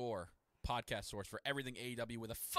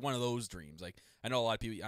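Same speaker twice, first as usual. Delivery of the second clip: normal talking voice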